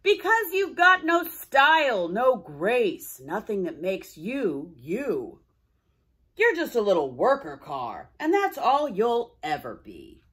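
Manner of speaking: put-on loud, scornful old streetcar jeering; a grumpy old man mocking a little car; gruff old-timer scoffing. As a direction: sneer it out like a scruffy old-timer. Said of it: "Old Mac".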